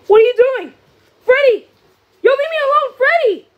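loud, high frantic protest; panicked shouting. Real, not acted. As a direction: shout at the attacker to back off. Freddy attacks, player panics.

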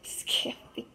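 whispered, exasperated name; annoyed sigh of name. Retold or imitated. Kid imitating herself fed up with her brother. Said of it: "I'm like".

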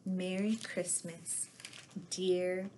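ordinary delivery

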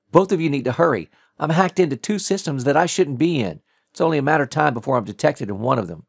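urgent hacker voice. Acted. Abby over headset.

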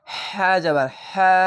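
হা slowly, with a strong breathed h; forced-out h. Shown as a demonstration. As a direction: draw out হা on a pushed breath. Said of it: "এই সাউন্ডটি বের করতে হবে". (হা, আওয়াজ বের করে টেনে)

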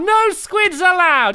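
loud, high, slow proclamation; hammed-up angry yell; mock outraged shout. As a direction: bellow the shop rule in pretend fury. Shopkeeper throws out the squid.

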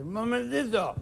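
put-on high, loud, slow drunk heckling; drunk heckler. Drunk at the front table heckling the magician, loud and slurred. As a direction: slur it out like a tipsy know-it-all from the front table. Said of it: "el borracho desde la mesa dice".

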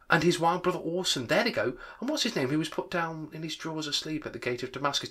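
high, animated gushing; worked-up, pitch leaping about; excited exclaiming. A narrator's reading. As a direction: tumble out memories in heightened excitement. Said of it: "most extraordinary voice between laughing and crying".

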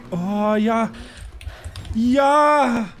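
excited cheer. Real, not acted. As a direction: shout it out in victory. Triumph in the game.

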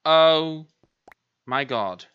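loud cry of disbelief; appalled outcry. Real, not acted. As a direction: cry out, aghast at the damage. Discovers further damage.

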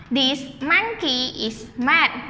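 slow, spaced read for the class; slow choral read. Shown as a demonstration. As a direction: read it slowly for everyone to join. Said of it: "Repeat after teacher".

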